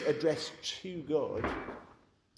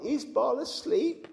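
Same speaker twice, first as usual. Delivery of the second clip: higher, slow sneering question; mocking taunt; 11 semitones higher, 6 dB louder, slower than usual. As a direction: taunt the false god, drawn out. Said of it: "the taunt that Elijah made".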